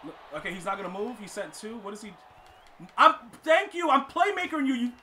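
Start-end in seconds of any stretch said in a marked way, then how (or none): 3.0-4.9 loud, high, urgent outburst; frantic gamer outburst. Real, not acted. Gamer panicking mid-play, frantic and loud.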